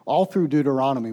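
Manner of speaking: ordinary talk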